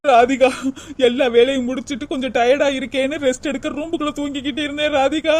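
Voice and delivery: usual speaking voice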